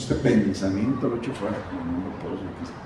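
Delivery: reprensión rápida y en voz más baja; oración de reprensión. Shown as a demonstration. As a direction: ora rápido reprendiendo el pensamiento. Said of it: "dice que lo eches fuera". (oración rápida y baja de reprensión)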